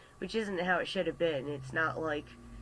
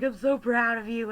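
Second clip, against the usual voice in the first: laughing; 7 semitones higher, 8 dB louder than usual. Retold or imitated.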